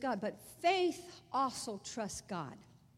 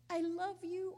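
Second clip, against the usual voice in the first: tearful; 7 semitones higher than usual. Retold or imitated. Repeated tearful farewell.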